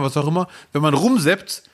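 ordinary talk

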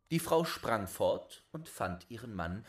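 plain reading voice

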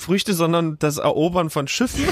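usual speaking voice

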